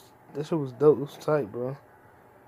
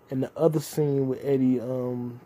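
ordinary talk

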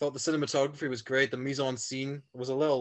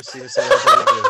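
laughing; 14 dB louder, faster than usual. Real, not acted. Laughs mid porn review bit.

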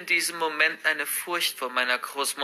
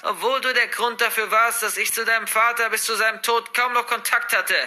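put-on higher, louder spiteful old woman; cold, reproachful grandmother; 6 semitones higher, 3 dB louder than usual. Grandmother reproaching her ungrateful granddaughter, spiteful. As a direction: lay the guilt on thick, bitterly.

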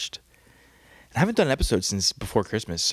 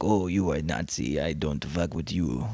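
lightly imitated, lower-pitched French accent; bad French accent; 4 semitones lower than usual. Podcaster mocking the French guy he talked to, bad French accent. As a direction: make a rough stab at a French accent. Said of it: "how does the French talk?".

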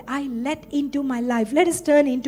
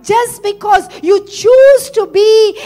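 raised, emphatic preacher's voice; forceful preaching; 3 semitones higher, 5 dB louder than usual. Real, not acted. Preacher urging congregation toward the promised land, forceful preaching. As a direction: build to a loud peak, then ease off.

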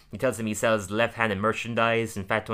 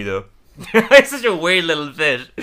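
normal talking voice

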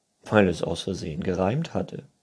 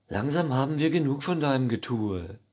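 higher, exasperated voice; impatient unicorn crowd; fed-up unicorns; 7 semitones higher, faster than usual. A narrator's reading. Unicorns lose patience. (genervte Einhörner)

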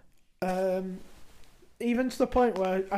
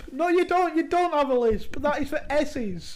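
louder, higher mock telling-off; retelling correcting a colleague, raised and insistent; exasperated correction; 6 semitones higher, 5 dB louder than usual. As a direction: firmly correct him, half laughing. Arguing with coworker, retold.

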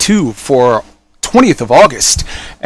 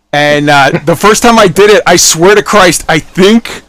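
ordinary talk, nothing marked

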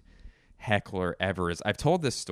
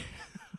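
real laugh at the joke; burst of laughter; 17 dB quieter than usual. Real, not acted. Laughing at the story.

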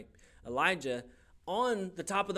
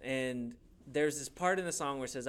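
usual speaking voice

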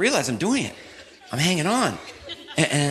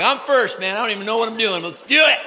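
eager and clueless; 7 semitones higher than usual. Retold or imitated. Eager newbie before tubing.

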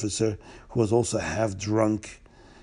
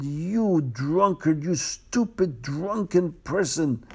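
retold scream; 4 semitones higher, slower than usual. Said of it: "I screamed at the officer".

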